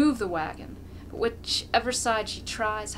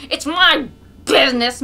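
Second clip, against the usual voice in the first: loud, higher defiant yell; angry yell; 6 semitones higher, 13 dB louder than usual. A narrator's reading. Mokolka yelling at the crowd to stay out of it, angry. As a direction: bark it back at the crowd. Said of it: "Mokolka yells".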